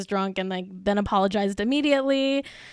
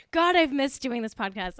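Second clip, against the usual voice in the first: ordinary delivery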